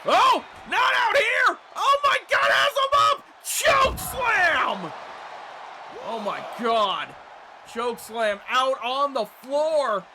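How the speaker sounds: loud, high excited play-by-play; wrestling commentator. Acted. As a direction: call the big moves like a ringside announcer.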